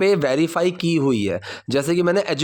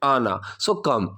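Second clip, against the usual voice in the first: normal talking voice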